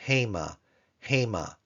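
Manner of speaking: hema with the stress on the last syllable, ma; Hebrew hema. Shown as a demonstration. Teacher demonstrating hema's final-syllable accent to students. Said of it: "followed by a comet's hey".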